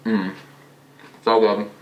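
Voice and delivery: chewing while speaking; mumbling with closed lips; mouth full of salad. Real, not acted. Seen 0: Bite taken at -2; lips closed, chewing through the mumbled words.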